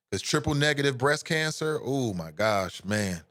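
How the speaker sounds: ordinary talk, nothing marked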